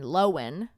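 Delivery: Lohan said LO-wen; two syllables, LO-wen for Lohan; corrected pronunciation. Shown as a demonstration. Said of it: "like, W E N".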